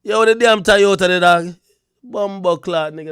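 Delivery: put-on loud, high patois cussing in Jamaican accent. Playing up the Jamaican talk, loud Jamaican accent. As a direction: holler it in broad Jamaican. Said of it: "That's Jamaican shit".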